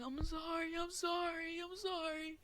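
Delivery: high, crying pleas of sorry; high tearful repeated apology; sobbing apology. Retold or imitated. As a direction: beg forgiveness through tears. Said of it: "I started crying, like, sobbing".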